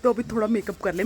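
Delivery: ordinary talk, nothing marked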